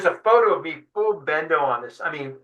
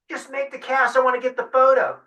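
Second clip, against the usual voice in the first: higher-pitched, eager imitation of the photographer; photographer urging; 9 semitones higher than usual. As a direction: push him, pitched up, to cast. So Decker goes.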